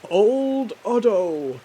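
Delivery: loud, drawn-out call. Real, not acted. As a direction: call out the name slowly. Calling out a name.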